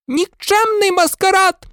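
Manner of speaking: very high-pitched outraged cry; furious yelling. A narrator's reading. Professor McGonagall over the fallen Slytherins, yelling furiously. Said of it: "репетувала вона".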